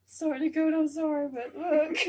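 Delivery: giggly loud sorry; laughing apology. Real, not acted.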